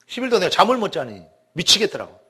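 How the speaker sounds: ordinary talk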